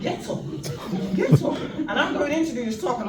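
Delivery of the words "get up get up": high, loud laughing repeat; amused incredulous repeat; laughing echo. Retold or imitated. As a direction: laugh as you throw his words back. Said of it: "echoing his words".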